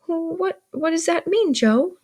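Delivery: put-on high-pitched questioning boy; curious child. A narrator's reading. Young Pip asks Joe about guns, curious. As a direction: ask it like an inquisitive child.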